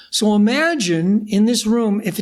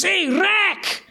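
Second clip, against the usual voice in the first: thick Scottish brogue, pitched up; imitating a Scottish rocker's praise; Scottish accent; 8 semitones higher than usual. Rocker praising Rick after show, in thick Scottish accent. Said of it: "really thick Scottish accents".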